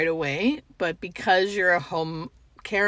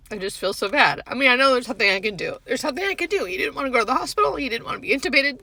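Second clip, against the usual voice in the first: crying; 5 semitones higher, 3 dB louder, faster than usual. Real, not acted. Nurse crying over patient death.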